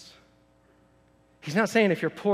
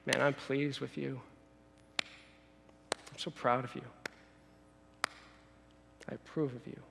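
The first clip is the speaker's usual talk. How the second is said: approving God; 3 semitones lower, 8 dB quieter than usual. Acted. God applauding his people from the throne, quiet approval. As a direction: praise them quietly, with pauses to let it land. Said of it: "just imagine that, that God is up on his throne".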